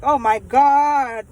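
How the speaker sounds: loud, slow, stretched-out groan of dread; acted dismay. Daughter caught with boyfriend hidden, loud dismay. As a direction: drag it out in mock horror.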